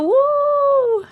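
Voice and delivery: high, long "woo"; sustained cheer, well above speaking pitch; excited whoop. Real, not acted. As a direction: let out a high celebratory whoop. Cheering a viewer on.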